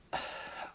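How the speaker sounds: very soft, breathy sigh; dejected sigh. Real, not acted. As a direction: sigh quietly at how bad it is. Lamenting the film's bad writing.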